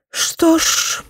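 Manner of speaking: breathy unvoiced sighed words; displeased sigh. A narrator's reading. Mother sighing with displeasure after the doctor leaves. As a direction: let it out as a disapproving sigh. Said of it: "недовольно вздохнула".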